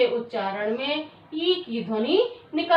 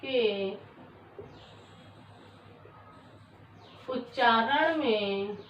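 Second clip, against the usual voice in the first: a word or two, then a very long pause; halting, drawn-out word-by-word delivery; slow dictation; 3 semitones lower, 8 dB quieter, slower than usual. Shown as a demonstration. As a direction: dictate piece by piece for someone writing along. Dictating definition while writing it out.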